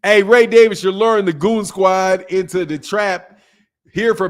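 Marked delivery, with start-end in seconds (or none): none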